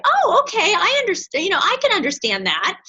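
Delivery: high, gentle acceptance; understanding. Shown as a demonstration. Coach showing how to accept a no, gently. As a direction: accept the no graciously. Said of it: "you don't wanna battle people".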